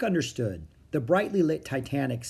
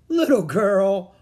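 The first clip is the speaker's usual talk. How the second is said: slow, loud, higher address with a touch of amusement; amused condescension; 7 semitones higher, 8 dB louder, slower than usual. A narrator's reading. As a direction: talk down to a child, amused. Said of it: "one said with a laugh".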